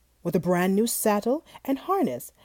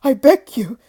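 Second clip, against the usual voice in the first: pleading in horror; 3 semitones higher, 10 dB louder than usual. Acted. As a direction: beg for your life, tumbling over the words. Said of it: "pleaded Mister Fortune in horror".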